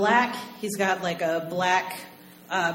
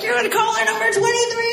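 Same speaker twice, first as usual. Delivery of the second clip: much higher, louder winner announcement; excited DJ; 14 semitones higher, 6 dB louder, faster than usual. Retold or imitated. Radio DJ telling the winning caller, excited. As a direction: announce the winner with a big lift.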